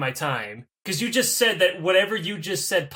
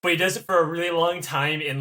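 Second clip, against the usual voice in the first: ordinary talk, nothing marked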